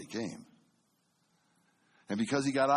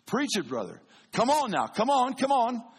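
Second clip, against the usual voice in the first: high, loud congregation shouts of encouragement; 10 semitones higher, 6 dB louder than usual. Retold or imitated. Church member urging on the preacher, high and loud. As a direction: call out to the preacher like the amen corner. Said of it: "They start talking to you".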